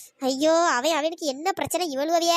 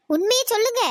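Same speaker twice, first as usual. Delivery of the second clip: stern demand; 5 semitones higher, 5 dB louder than usual. Acted. Aunty demands the truth.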